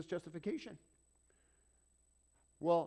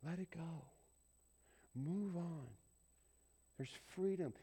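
soft, calm let it go; gentle urging; 5 dB quieter than usual. Acted. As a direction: coax them to peace.

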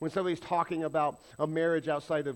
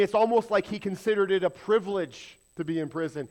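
usual speaking voice

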